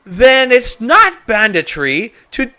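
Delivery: high, loud mocking reasoning; mock their logic slowly; sarcastic challenge. Shopkeeper mocking the adventurers' excuse.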